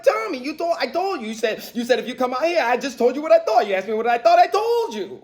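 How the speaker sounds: high, flustered protest; De Niro. Retold or imitated. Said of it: "I'm like De Niro, I'm like".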